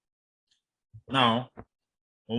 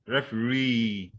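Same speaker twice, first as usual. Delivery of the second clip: referee slowly, REE drawn out; stress on third syllable; slower than usual. Shown as a demonstration. Teacher giving a third-syllable example, referee stressed on REE. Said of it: "on the third syllable".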